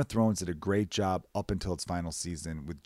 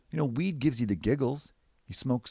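ordinary talk

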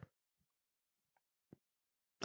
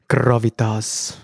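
GRAVITAS drawn out slowly in a muddled put-on accent; odd unplaceable accent; slower than usual. Host comparing his readings, GRAVITAS in a botched accent. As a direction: stretch out GRAVITAS in an accent of no known origin. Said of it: "I don't know what accent that was".

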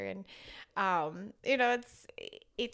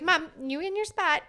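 put-on louder, higher little-girl order, lightly done; imitating a bossy toddler; 7 semitones higher, 6 dB louder than usual. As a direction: boss mom around like a tiny queen.

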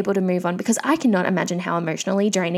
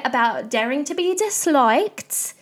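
put-on playful, drawn-out, higher Essex accent; 3 semitones higher, slower than usual. Podcaster wrapping up her rant, mock Essex accent. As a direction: put on Essex for a lilting sign-off. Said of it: "I love doing an Essex accent".

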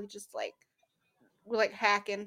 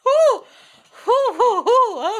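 excited ooh; 11 semitones higher, 13 dB louder, slower than usual. Real, not acted. Excited reaction to dancer.

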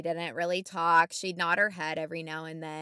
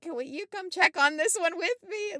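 much higher, wheedling plea; pleading request; 11 semitones higher, 4 dB louder than usual. Retold or imitated. Nurse begging a coworker to come check with her, high plea. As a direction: ask high and needy for company.

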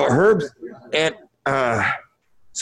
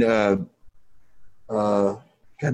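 normal talking voice

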